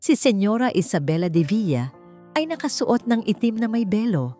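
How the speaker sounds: ordinary delivery, nothing marked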